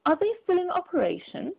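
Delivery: lightly voiced miser demanding; Scrooge demanding. Scrooge demands of the gentleman, mild scorn. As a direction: press the question, a touch scornful. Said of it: "demanded Scrooge".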